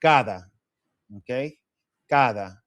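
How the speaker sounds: "Cada" with a soft th-like d; "Cada" with the tongue lightly against the teeth; soft Spanish d. Shown as a demonstration. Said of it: "pronounce with tongue against the teeth".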